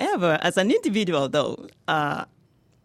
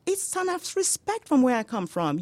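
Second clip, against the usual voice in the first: much higher, taken-aback protest; indignant protest; 10 semitones higher than usual. Retold or imitated. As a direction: push back, offended and surprised. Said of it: "And I was like".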